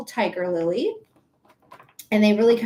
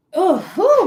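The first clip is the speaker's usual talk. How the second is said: hiccup; 10 semitones higher, 6 dB louder than usual. Real, not acted. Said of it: "my hiccups".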